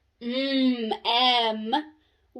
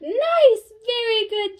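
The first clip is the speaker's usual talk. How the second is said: Nice, much higher and louder; excited praise; 7 semitones higher, 6 dB louder, slower than usual. Shown as a demonstration. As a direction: cheer the word Nice. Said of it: "I hear a couple of you".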